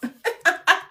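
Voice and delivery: a short high burst of laughter. Real, not acted. Vlogger laughing at her own three-month promise, a short high laugh. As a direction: laugh lightly at your own boast.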